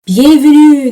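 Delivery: a hammed-up French accent on bienvenue; playful French accent with a little lift; French greeting. Shown as a demonstration. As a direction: greet the viewers in mock French. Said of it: "Terrible accent, sorry".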